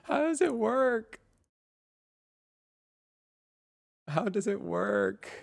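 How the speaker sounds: slow, high-pitched squeal of amazement; marveling, voice way up and drawn out; astonished wonder. Real, not acted. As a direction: stretch the words out in high disbelief. Astonished at how it works.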